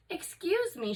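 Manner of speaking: high, cheerful and polite; chirpy little greeting at the door; bright. Acted. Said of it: "she said brightly".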